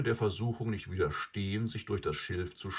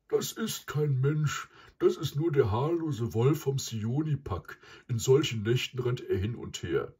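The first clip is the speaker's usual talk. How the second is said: higher, emphatic bull voice; raised, forceful animal character; buffalo character; 6 semitones higher, 4 dB louder than usual. A narrator's reading. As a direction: dismiss it as the buffalo, pitched up. Buffalo Mysa answers his cow.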